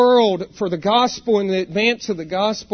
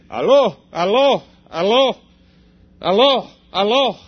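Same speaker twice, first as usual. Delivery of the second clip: imitating a man repeating "Aló" into the phone, a bit louder; Ukrainian phone greeting; 5 dB louder than usual. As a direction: call "Aló" again and again like a man on a bad line. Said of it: "repeatedly he said".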